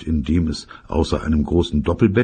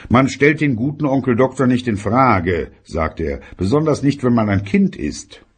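stern father voice; 8 semitones higher than usual. Acted.

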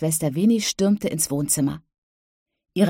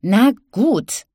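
put-on high, louder petulant little-girl voice; stomping child; 5 semitones higher, 5 dB louder than usual. A narrator's reading. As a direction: give in with a stomp. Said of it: "stampfte mit ihrem winzigen Fuß".

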